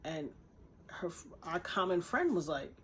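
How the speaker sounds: ordinary delivery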